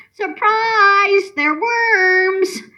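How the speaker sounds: high, stretched-out announcing call; yelled surprise. Acted. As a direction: hold each big word long and high. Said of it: "and when I yelled".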